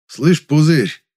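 drawled thug slang, loud; russian prison slang. A narrator's reading. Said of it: "растягивая слова на блатной манер".

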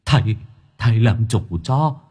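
a frightened spirit pleads, mildly whimpering; whimpering ghost. Acted. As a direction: beg the exorcist, whimpering and rising as the fear builds. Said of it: "sợ mất mật mếu máo".

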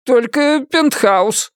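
high, slow reluctant offer; awkward, grudging admission; muttering agent. A narrator's reading. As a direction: drag it out hesitantly, pitched high. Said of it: "пробормотал он".

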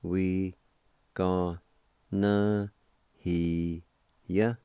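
"We gonna hear ya" broken into separate syllables with pauses; evenly held, isolated syllables in "We gonna hear ya"; syllable-by-syllable pronunciation. Shown as a demonstration. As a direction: sound out "We gonna hear ya" piece by piece. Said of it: "It's pronounced like this".

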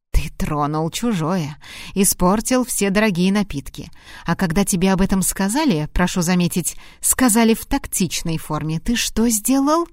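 measured, controlled scolding; restrained reproach. Acted. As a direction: scold him without raising the voice. Выговор Харту, первый дубль. (сдержанный укор)

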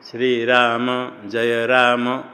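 every final अ sounded: shree raa-ma, ja-ya raa-ma; Sanskrit final vowels. Shown as a demonstration. Teacher models Sanskrit, श्री राम, जय राम with final अ voiced. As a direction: say श्री राम, जय राम slowly, voicing the vowel after य and म. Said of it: "Sanskrit में जब उच्चारण करेंगे तो".

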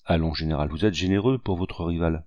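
plain reading voice

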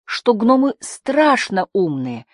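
high, drawn-out wail; plaintive high-pitched lament. A narrator's reading. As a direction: lament it slowly, voice climbing high. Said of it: "запричитала Прин".

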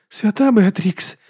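high, slow, horrified stage whisper; whispering in shock. Acted. Monk watching dark hair sprout, whispering. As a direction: breathe it in horror, drawn out. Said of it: "прошепотів брат Діас".

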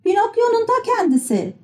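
very high-pitched puppet exclamation; crying out. A narrator's reading. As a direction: exclaim high, without extra volume. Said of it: "diye haykırdı". (tiz sesle haykırış)

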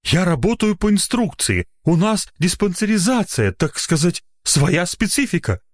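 high-pitched defensive official; hasty self-justifying. A narrator's reading. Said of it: "торопливо начал оправдываться доктор".

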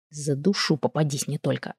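quiet, simmering warning; muttered threat. A narrator's reading. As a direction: threaten under your breath. Muttered threat to the hiding familiar.

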